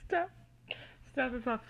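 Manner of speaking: ordinary talk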